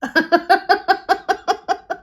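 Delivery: high-pitched giggle in a quick run; real, high, rippling laugh. Real, not acted. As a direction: giggle at your own silliness. Laughs at own silly accent.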